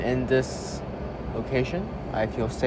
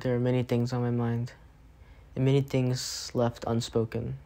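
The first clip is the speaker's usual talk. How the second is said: quiet prayer; 6 dB quieter than usual. Real, not acted. Quiet prayer after her chat.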